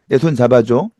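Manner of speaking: normal talking voice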